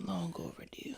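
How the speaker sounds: whispered aside; breathy whispered joke. Real, not acted. Joking aside.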